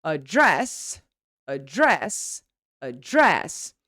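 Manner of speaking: "Address" as a-DRESS, repeated; weak "a", land hard on DRESS in "Address"; stressed second syllable. Shown as a demonstration. Teacher drilling the verb, second-syllable stress on "Address". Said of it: "Look how the syllable is much longer".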